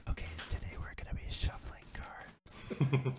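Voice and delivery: slow ASMR whisper. Pretend. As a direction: whisper slowly like an ASMR host. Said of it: "Today on this A S M R episode".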